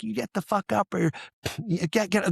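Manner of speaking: high, held-back imitation of yelling; mock yell. Cadre to soldier in sleeping bag, mock yell. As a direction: act out a yelled order without raising the volume. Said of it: "the cadre start yelling at him".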